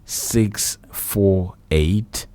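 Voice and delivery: reading out a phone number slowly, digit by digit. Acted. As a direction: spell the line out slowly for listeners. Repeats the station number.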